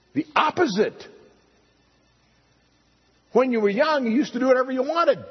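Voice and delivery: high, rapid, emphatic preaching; excited emphasis. Real, not acted. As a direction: press the contrast with energy.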